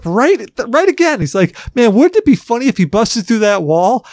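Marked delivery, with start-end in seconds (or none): 1.7-4.1 higher mock teasing voice; imitating friend's jinx. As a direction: tease like a friend jinxing you.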